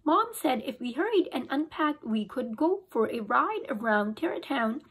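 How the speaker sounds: ordinary delivery, nothing marked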